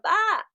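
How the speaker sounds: a high, wide-open "ah". Shown as a demonstration. Dubbing demo showing the open mouth shape, a high "ah". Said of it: "leaving the mouth open with the".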